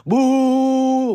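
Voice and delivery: a high mock boo from the stands; crowd booing. Comedian imitates fans booing him onstage, high mock boo. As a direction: boo him like a heckler in the seats.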